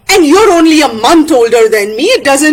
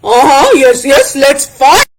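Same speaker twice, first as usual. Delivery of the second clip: loud, fired-up acted retort; worked-up quarrel voice; combative acceptance; 6 dB louder than usual. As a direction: take up the challenge forcefully. Accepts the fight in story.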